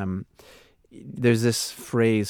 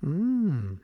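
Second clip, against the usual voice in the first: normal talking voice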